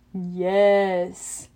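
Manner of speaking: a Cyclops, proud and slow; the giant gloats over his treasure. A narrator's reading. Cyclops to Grover, proudly. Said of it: "Polyphemus said proudly".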